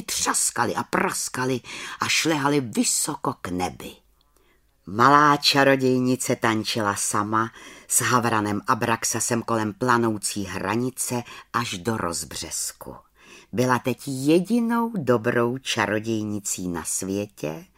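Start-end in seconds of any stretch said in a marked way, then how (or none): none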